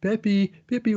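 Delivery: high, loud call for a lost pet. Retold or imitated. Girls searching for their lost birdie, calling loudly. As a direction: call the bird's name across the field.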